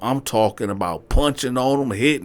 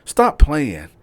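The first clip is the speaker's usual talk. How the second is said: higher, louder, sassy stop playing; doubtful brush-off; 6 semitones higher, 6 dB louder than usual. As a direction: wave him off in disbelief. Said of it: "She was like".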